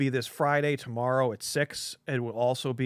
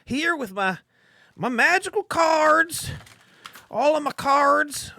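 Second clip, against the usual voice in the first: Joe impression; 14 semitones higher, 6 dB louder than usual. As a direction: do the shop owner's over-the-top TV pitch. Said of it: "That's my Joe impression".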